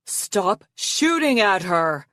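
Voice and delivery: slow, forceful roar. A narrator's reading. Arctic defending Foeslayer from the archers, roaring. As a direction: roar it out, drawing the words long. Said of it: "Arctic roared".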